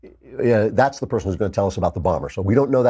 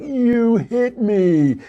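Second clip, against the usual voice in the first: much higher, slow, wailing crybaby; a pundit mimics a tattling little brother, slow and much higher; whiny little kid; 11 semitones higher, much slower than usual. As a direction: whine it out like a bratty kid who got hit. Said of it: "the kid's like".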